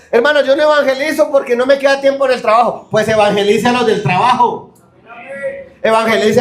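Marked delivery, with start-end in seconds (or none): 0.1-2.9 high whiny excuse voice; mock excuse. Preacher mocking believers' excuses, higher whiny voice. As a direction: mimic the excuse with a higher pitch. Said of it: "hay gente que pone unas excusas".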